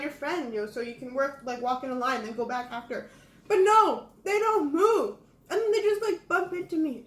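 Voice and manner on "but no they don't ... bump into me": high, loud, laughing exasperation; worked up and laughing at the rudeness; exasperated. Real, not acted. Complaining about sidewalk bumps, laughing.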